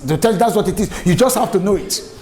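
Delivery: normal talking voice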